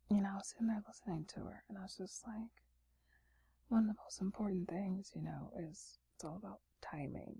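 sleepy late-night whisper. Real, not acted. ASMR whisper throughout.

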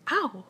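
high, sharp "ow"; yelp of pain. A narrator's reading. Pooh pulled by his front paws, yelping in pain. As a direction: yelp as if being tugged.

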